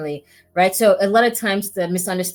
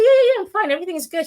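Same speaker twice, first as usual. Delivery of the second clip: much higher, louder breezy yeah yeah; fake reassurance; 12 semitones higher, 5 dB louder, much faster than usual. Telling her colleague she's fine when she isn't, falsely breezy. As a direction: brush it off too brightly, clearly not fine. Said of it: "And I said".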